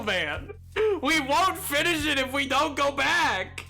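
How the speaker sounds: ordinary talk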